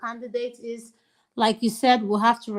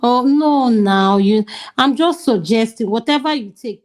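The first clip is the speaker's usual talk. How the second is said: much louder, cutting over him; loud retort; 9 dB louder than usual. Real, not acted. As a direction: talk over him loudly. Heated argument, sarcastic put-down.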